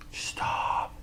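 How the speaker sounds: hushed whispered plea. Real, not acted. Whispering to the audience to just stop. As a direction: breathe it out as a final plea.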